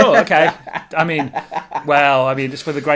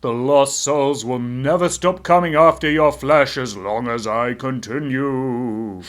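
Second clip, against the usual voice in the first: slowing, haunting ghost; 5 dB louder, slower than usual. Acted. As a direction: drag it out like a spooky ghost. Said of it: "stereotypical ghost voice".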